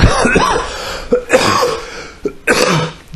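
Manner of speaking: a long, harsh coughing fit. Real, not acted. Coughing fit in pain while on the phone. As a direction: hack through the cough.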